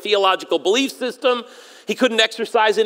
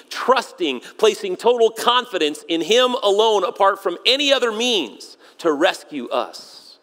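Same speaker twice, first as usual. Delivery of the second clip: normal talking voice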